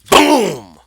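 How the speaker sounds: ordinary talk, nothing marked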